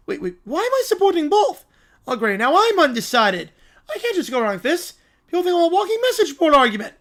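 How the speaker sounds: high, agitated villain complaint; exasperated cartoon villain. Villain hit by his own device, high exasperated fretting. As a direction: whine like a thwarted cartoon villain.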